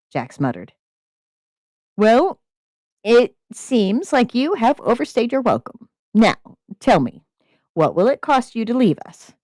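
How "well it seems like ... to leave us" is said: demanding. Acted. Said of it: "the man demanded".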